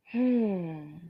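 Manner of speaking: usual speaking voice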